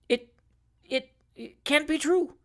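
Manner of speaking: slow, faltering, high stammer of disbelief; stunned stammer. A narrator's reading. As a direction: stumble over the words in shock. Pickwick stunned by letter.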